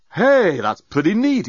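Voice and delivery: slow, high delighted drawl; impressed exclamation. A narrator's reading. Dan admiring Nettie's translator specs, impressed exclamation. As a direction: stretch it out in pleased amazement. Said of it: "exclaimed Dan".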